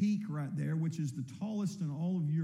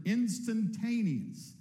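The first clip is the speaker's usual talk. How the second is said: instantaneous drawn out slowly and higher; emphasized word; 4 semitones higher, slower than usual. Shown as a demonstration. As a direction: lean hard on instantaneous. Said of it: "I want to emphasize the word".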